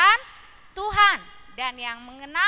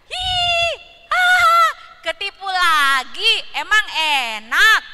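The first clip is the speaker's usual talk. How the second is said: giggling taunt; 2 semitones higher, 6 dB louder, slower than usual. Acted. Parrot giggles at its tricked friends again, loud gloating taunt. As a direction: cackle at the fooled friends again. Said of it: "dia kembali cekikikan".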